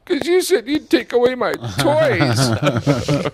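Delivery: put-on high, slow whiny kid voice, half laughing; mock the little boy's tearful complaint with a laugh; imitating crying child. Father imitates his tearful son, high and slow through a laugh. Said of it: "He goes".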